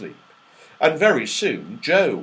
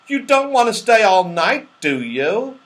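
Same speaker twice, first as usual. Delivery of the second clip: high, loud teasing question; Moonface teasing; 8 semitones higher, 5 dB louder than usual. Acted. Moonface teasing the rabbit at the top of the slide, high and loud. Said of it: "said Moonface".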